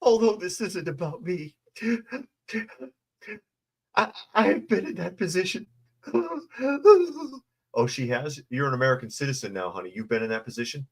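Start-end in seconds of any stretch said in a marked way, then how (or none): 0.0-5.7 very high, quivering parody of tearful speech; mocking a weepy councilwoman in a very high voice; mock crying. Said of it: "Giraldo said". 6.1-7.3 hammed-up high mock sob. Mock sob after quote.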